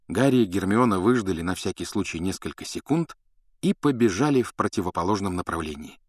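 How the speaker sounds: ordinary delivery, nothing marked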